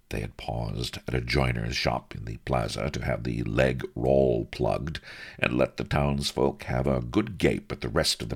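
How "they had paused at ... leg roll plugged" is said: plain reading voice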